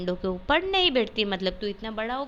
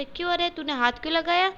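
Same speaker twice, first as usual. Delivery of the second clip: higher, fast scolding question; mother questions her son, higher and quicker; 7 semitones higher, faster than usual. Acted. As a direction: demand an answer from the boy. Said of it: "माँ ने केशव से पूछा".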